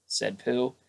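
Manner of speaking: usual speaking voice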